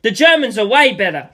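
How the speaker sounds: mild shout. Acted. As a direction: shout it across. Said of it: "I shouted".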